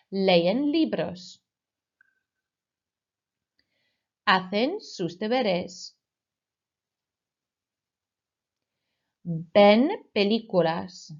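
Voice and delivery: slow, clear phrases for pupils to repeat; slow model phrase. Shown as a demonstration. Teacher slowly modelling they-form verbs for the class. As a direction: say each word slowly to be echoed. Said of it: "Repeat after me".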